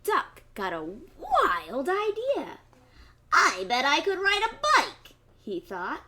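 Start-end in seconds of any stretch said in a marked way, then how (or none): 0.0-2.6 put-on lively read-aloud for kids; story narration. A narrator's reading. 3.3-5.1 higher, louder eager duck voice; excited duck. Acted.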